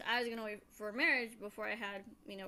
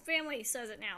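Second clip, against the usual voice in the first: ordinary delivery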